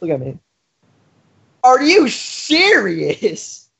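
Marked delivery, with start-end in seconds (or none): 1.6-3.7 very slow, stretched "serious"; exaggerated disbelief. Champ answering rival's mockery, exaggerated disbelief. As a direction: draw out each word in incredulous disbelief. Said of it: "you didn't say it right".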